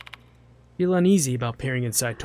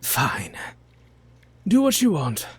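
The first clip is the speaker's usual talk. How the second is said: voice acting; 4 dB louder, slower than usual. Streamer voicing a fed-up character, mild grumble. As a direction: hand over the marker with a lightly voiced grumble. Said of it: "Now I'm actually voice acting".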